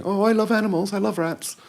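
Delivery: mimicking a pop star's tiny high voice. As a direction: squeak it up high and breathy. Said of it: "high-pitched, squeaky voice".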